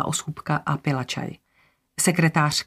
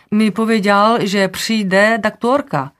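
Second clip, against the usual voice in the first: insistent cleaner; 5 semitones higher, 5 dB louder, slower than usual. A narrator's reading. Cleaner insists, voiced in story.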